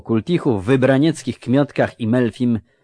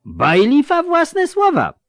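high, loud, drawn-out indignation; bristle, pitch shooting right up; indignant burgher; 5 dB louder than usual. A narrator's reading. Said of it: "zaperzył się mieszczanin". (zaperzony mieszczanin, wysoko i głośno)

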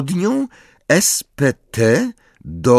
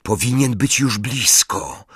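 plain reading voice

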